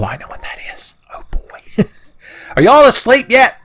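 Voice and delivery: ordinary delivery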